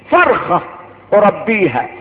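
ordinary talk

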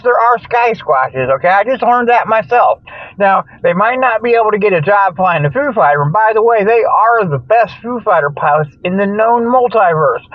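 ordinary delivery

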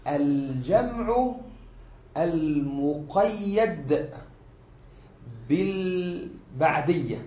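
each word held apart, very slow; slow dictation. Shown as a demonstration. Teacher spelling out the first kind for students. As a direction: lay out الجمع المقيد بالبعدية word by word. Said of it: "يشمل".

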